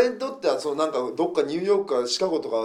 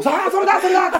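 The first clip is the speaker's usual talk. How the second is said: much higher, louder cheering; excited shout; 9 dB louder than usual. Retold or imitated. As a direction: shout it out like a thrilled fan. Excited fan shouting along.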